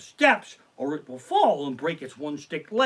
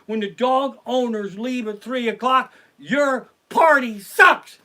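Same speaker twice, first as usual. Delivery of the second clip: shouted, much louder and higher; loud rant; 7 semitones higher, 12 dB louder than usual. Real, not acted. Comic yelling at dog owners to fix their party. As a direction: yell it at the dog owners like a final verdict.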